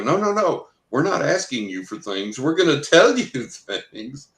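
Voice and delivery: high, emphatic mock insistence; insistent retold protest. Friends correcting the psychic about confidentiality, insistent. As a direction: imitate friends cutting in, pitch jumping up.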